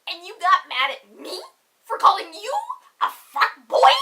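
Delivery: outraged rhetorical question; building indignation. Real, not acted.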